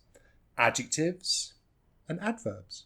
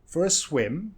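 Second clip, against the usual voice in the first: quick "for a", then SWIM long and rising; weak "For a", strong SWIM; stress on SWIM; 4 semitones higher than usual. Shown as a demonstration. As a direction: make "swim" stand out. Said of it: "want that swim to stand out".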